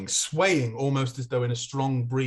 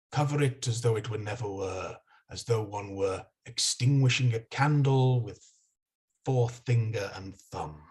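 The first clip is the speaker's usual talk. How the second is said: richer, friendlier voice; 4 semitones lower than usual. Acted. Unseen voice tempting a little thing, low and sweet. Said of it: "the voice again, richer this time and, and friendlier".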